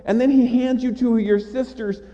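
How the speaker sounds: high-pitched, elated preaching; joyful excitement. Acted. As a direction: tell it as an overjoyed reunion, voice lifted high. Joyful climax of the Lazarus reunion.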